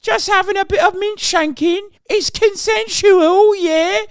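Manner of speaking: high, hammed-up British lad voice; mock British accent. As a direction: lampoon a cheeky British bloke. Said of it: "the British authorities would be like".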